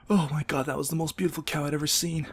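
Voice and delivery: high-pitched mock-tearful awe; hammed-up emotional wonder; choked up. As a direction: gush as if pretending to fight back tears. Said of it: "I'm getting choked up again".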